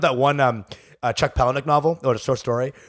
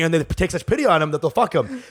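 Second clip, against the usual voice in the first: ordinary talk, nothing marked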